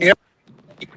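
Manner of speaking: choppy, cutting-out call audio; bad connection dropping words; breaking up. Real, not acted. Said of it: "He's breaking up real bad".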